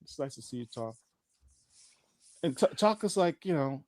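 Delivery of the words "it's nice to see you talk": softer, bored mock reply; dismissive quiet brush-off. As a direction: shrug him off quietly. Chocolate brushes him off.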